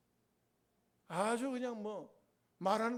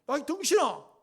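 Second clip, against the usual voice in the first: higher, louder scornful yell at a husband; mock yell; 7 semitones higher, 9 dB louder than usual. Wife insulting her husband, hammed-up loud yell. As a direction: bark the insult like an outraged wife.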